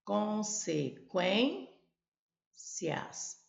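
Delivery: Consequências drawn out syllable by syllable; slow syllables. Shown as a demonstration. Teacher repeating the exam board's preferred split. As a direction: say Consequências in slow separate beats. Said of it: "a preferência dela é a que eu vou pegar".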